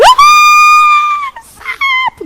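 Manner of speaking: high panicked shriek, then a sharp drop; mock terrified shriek at a frog; scream. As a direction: scream it like the bishop seeing a frog. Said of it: "Imagina a bispa gritando".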